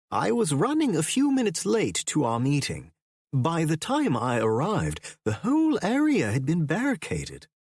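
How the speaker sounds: put-on an elderly English academist explaining, unsettled; professor's voice. A narrator's reading.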